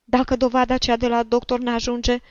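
put-on high-pitched old woman begging a favour; insistent, high-voiced petitioning; pleading old woman. A narrator's reading.

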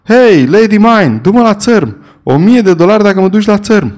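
desperate shout from the ship's rail to a pilot boat. A narrator's reading. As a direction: shout across the water, high and loud. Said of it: "strigând".

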